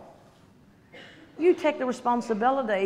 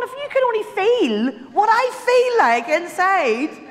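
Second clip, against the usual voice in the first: high, slow, pleading laugh-through reply; a touch of mock despair, laughing; slow high plea with a laugh; retold plea; 9 semitones higher, 5 dB louder, slower than usual. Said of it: "I'm going".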